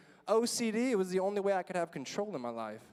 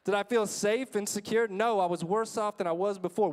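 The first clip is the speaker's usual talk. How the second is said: ordinary talk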